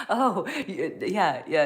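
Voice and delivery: laughing at the compliment; a bright laugh breaking through. Real, not acted. Laughs at newscaster remark.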